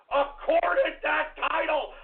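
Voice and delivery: usual speaking voice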